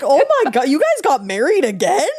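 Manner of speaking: high, loud laughing outrage; shocked disbelief. Retold or imitated. A viewer shocked by the movie's plot, high and loud. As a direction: gasp it out, half laughing. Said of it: "I'm like".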